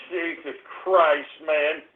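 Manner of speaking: low, slow, soft sigh of words; weary dismay. Real, not acted. Host despairing over trolls and cyber vermin, weary dismay. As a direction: trail down in defeat. Gives up mid-complaint.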